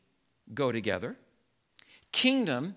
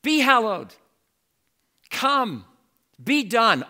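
"Be hallowed", "Come", "Be done" as loud, higher commands; commanding imperatives; 5 semitones higher, 7 dB louder, slower than usual. Shown as a demonstration. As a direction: bark each verb as an order. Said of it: "Jesus tells us to use the imperative".

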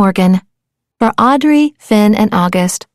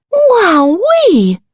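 delighted wowee; 7 semitones higher than usual. Acted. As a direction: gasp with delight. Terrence finds a new shell.

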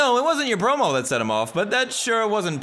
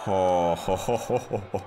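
normal talking voice

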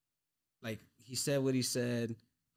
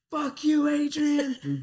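high, loud mocking shout at a fighter; heckler's yell; 14 semitones higher, 9 dB louder, much slower than usual. Heckler screaming at the fighter walking out, loud high yell. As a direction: bellow it like a heckler in the crowd. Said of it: "that one fucking guy was like".

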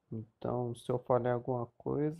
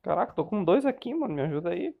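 higher, louder urgent cry for help; panicked gamer; 5 semitones higher, 5 dB louder than usual. Real, not acted. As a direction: shout for help, outnumbered. Outnumbered, asks for help. (gamer em pânico)

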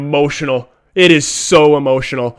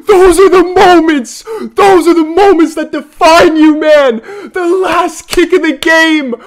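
much higher and louder, still agitated; worked-up fan; 12 semitones higher, 5 dB louder than usual. Real, not acted. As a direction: rant in breathless disbelief. Fan after a miss.

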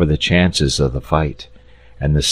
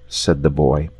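plain reading voice

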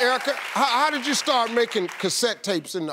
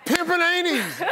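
higher, laughing punchline delivery; quoting his routine; 8 semitones higher than usual. Retold or imitated. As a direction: toss off the old bit with a laugh. Host quotes his own comedy routine.

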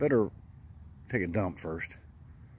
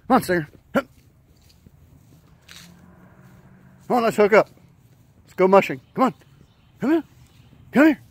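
calling the dog; 10 semitones higher, 4 dB louder, faster than usual. Real, not acted.

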